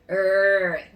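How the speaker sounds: long growled R; puppy-like growl. Shown as a demonstration. Teacher likening R to a puppy, growled R. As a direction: growl a sustained "rrr" like a little dog. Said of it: "parece o rosnado de um cachorrinho".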